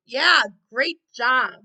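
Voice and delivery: big, slow, loud cheer of praise; excited praise. Real, not acted. Teacher cheering the class after they raise the roof, loud and drawn out.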